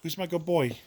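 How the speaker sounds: put-on high pet baby talk; squeaky praising a dog. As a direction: coo the question at the dog.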